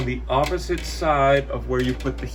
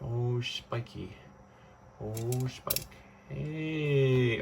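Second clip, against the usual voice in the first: normal talking voice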